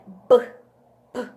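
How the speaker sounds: "B" as a short bare consonant burst; sound of B. Shown as a demonstration. Teacher showing the sound of B, short isolated pops. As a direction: pop out "B" as a sound, not its letter name. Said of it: "do som do B".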